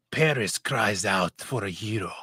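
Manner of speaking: hammed-up French accent, low; dramatic narrator with a put-on French accent. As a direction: proclaim it in a mock French accent. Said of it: "This is my French accent".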